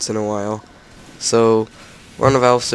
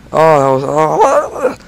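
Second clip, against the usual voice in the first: ordinary talk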